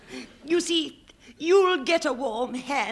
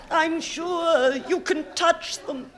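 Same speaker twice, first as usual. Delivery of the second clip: rousing encouragement; 4 semitones higher, 6 dB louder than usual. Acted. Wife encourages the actor.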